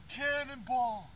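slow drawn-out call; cannonball yell. Real, not acted. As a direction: stretch out the announcement. Announcing jump, drawn out.

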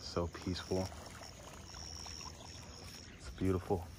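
quiet awe at the scene; hushed. Real, not acted. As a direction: hush so the listener hears the outdoors. Pausing to let nature sound play.

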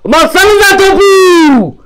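high, loud furious yell; angry shout. Real, not acted. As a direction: scream at them to shoot. Furious at teammates not shooting.

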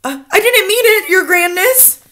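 crying out. A narrator's reading. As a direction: plead upward to a furious leader. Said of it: "the witch cried out".

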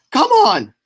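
yelling. Real, not acted. Yelling at rival racer.

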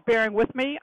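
ordinary talk, nothing marked

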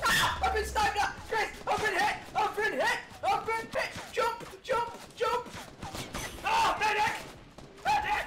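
high panicked yelling, half laughing; man down shout. Real, not acted. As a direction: scream for a medic like you're hit. Shot during game, calling teammate.

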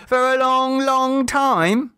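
put-on high child's voice with a laugh; a child enjoying the bargain; mocking child. A narrator's reading. Children press demand.

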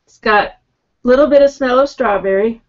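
glass rim at lips; muffled behind glass; speaking into a glass. Real, not acted. Seen 2: Beer glass held against mouth and nose throughout the labelled words.

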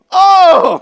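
loud, high "Oh!" with a laugh; mock surprise. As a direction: imitate a stranger suddenly getting it. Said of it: "I'm like".

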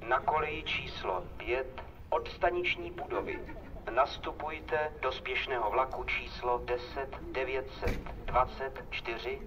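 normal talking voice

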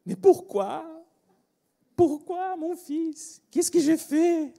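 high, loud mock maternal wail; dismayed mother. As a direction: cry out like a distraught mum, drawn out. Acting out his mother's dismayed reaction.

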